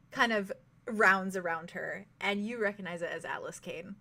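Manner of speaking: normal talking voice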